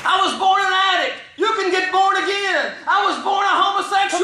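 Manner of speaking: very high, shouted sermon chant; full-force preaching. Real, not acted. Preacher proclaiming new birth to his church, full-force preaching. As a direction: cry it out to the congregation in punched phrases.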